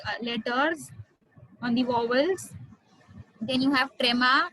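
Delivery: ordinary delivery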